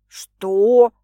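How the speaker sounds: loud startled question; taken-aback man; surprised. A narrator's reading. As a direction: burst out in surprise. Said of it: "удивился мужчина".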